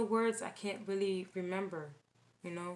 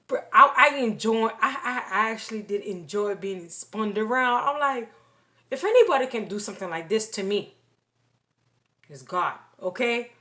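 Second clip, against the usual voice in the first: excited; 2 semitones higher, 11 dB louder than usual. Real, not acted. Thrilled at being spun by God.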